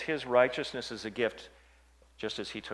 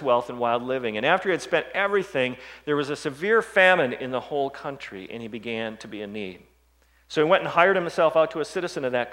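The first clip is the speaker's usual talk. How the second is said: preaching the story; 4 semitones higher, 8 dB louder than usual. Acted. Retelling the prodigal son.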